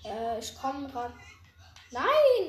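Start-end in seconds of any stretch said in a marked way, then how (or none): 1.9-2.5 sudden high loud NO; panicked shout. Real, not acted. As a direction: yell it out in shock. Panicked outburst in game. (panischer Schrei)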